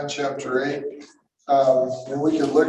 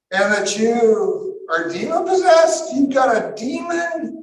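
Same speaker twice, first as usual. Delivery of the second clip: acted high, loud accusation, climbing to a shout; hammed-up disbelieving outburst; outraged accusation; 8 semitones higher, 5 dB louder than usual. Crowd accusing Jesus of having a demon, outraged.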